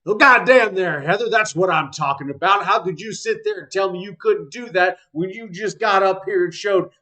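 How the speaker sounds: loud, high coach's holler; tactics teacher. Acted. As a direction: holler praise and challenge across the training yard. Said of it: "the tactics teacher. Uh, he says".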